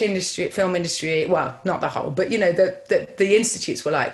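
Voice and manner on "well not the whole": ordinary talk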